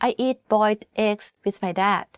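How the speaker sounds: ordinary talk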